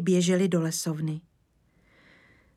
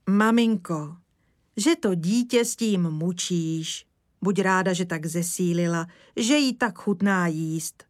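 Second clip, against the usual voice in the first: plain reading voice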